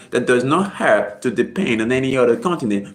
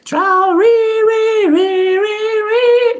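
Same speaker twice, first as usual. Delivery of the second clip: long high calls of Traore, louder, half sung; shouting the name; 6 dB louder, much slower than usual. Shown as a demonstration. Vlogger to viewers, celebrating the leader with high loud sung calls. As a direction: chant the name like a rally cry. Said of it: "it's like you're shouting the name".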